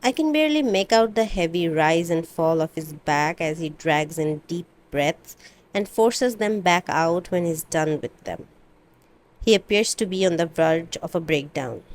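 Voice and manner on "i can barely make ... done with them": usual speaking voice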